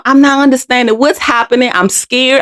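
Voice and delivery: usual speaking voice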